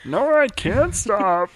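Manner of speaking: high, slow cartoon alien voice; defiant little Martian; Marvin the Martian. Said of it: "Marvin the Martian impression".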